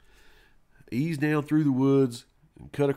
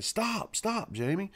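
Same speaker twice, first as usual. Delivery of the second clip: higher mock scolding of a pestering kid; grandma shushing; 9 semitones higher, slower than usual. Grandma on the phone shushing her grandson, higher hushed scold. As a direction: hand over the phone, hush the boy higher.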